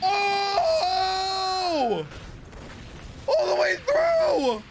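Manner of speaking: very high, drawn-out cheer; thrilled screaming. Real, not acted. Viewer cheering the finishing blow, thrilled scream. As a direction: scream as the kick smashes through.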